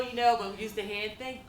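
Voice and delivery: ordinary talk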